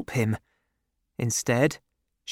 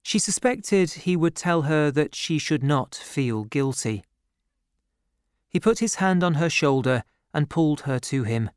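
plain reading voice

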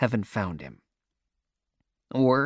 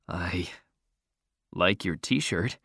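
low, soft, halting compliment; nervously offered, slow and quiet; nervous boy; 6 semitones lower, 4 dB quieter, slower than usual. A narrator's reading. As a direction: hesitate shyly, slow and low. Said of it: "he says nervously".

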